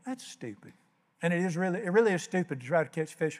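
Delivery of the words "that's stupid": much softer, higher inner scoff; muttered thought. Retold or imitated. Preacher thinking about the stranger's advice, scoffing quietly. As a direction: say it under your breath, scoffing. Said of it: "and I'm thinking".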